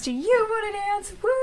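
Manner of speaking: high, cheery showtime hype; hyped up. Retold or imitated. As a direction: sell it like a hostess working the room. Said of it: "That's my dude during show times".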